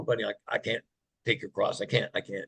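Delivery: low, hushed, halting protest; gentle refusal. Retold or imitated. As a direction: turn down a child's gift softly. Refusing sick child's gold cross.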